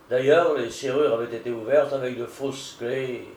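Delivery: ordinary delivery, nothing marked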